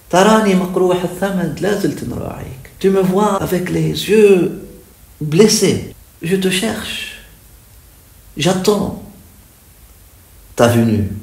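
high, strong devotional lament; yearning recitation. Acted. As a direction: call out the longing, phrase by phrase. Recites and translates the verse. (récitation ardente, pleine d'attente)

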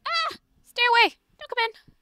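high, loud panicked cry; yelp, then shout them out; startled shout. Acted. Will yells at her mother to stay out, startled and high.

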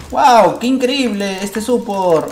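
grito de asombro, agudo y fuerte; exclámalo maravillado; emocionado. Real, not acted. Big play in the game. (asombro a gritos)

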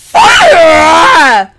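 loud panicked yell; shout. Real, not acted. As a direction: bellow it in alarm.

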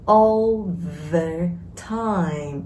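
"All the time" stretched word by word; very slow demonstration. Shown as a demonstration. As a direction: draw out each word for learners. Teacher models phrase slowly for learners. (đọc mẫu thật chậm, kéo dài từng từ)

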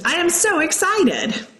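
high, slow, gushing excitement; excited exclaim. Shown as a demonstration. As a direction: read it like you can barely contain yourself. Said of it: "Hannah exclaimed".

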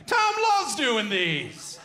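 slow, high mock-excited newsman voice; bad Stephen Quinn impression. Host mocking a co-host's intro, hammed-up newsman. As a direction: ham up a colleague's gushing intro. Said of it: "the worst Stephen Quinn impression".

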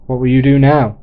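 loud call across a distance; shouting out. Acted. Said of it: "he shouted out".

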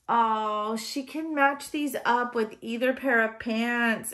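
ordinary delivery

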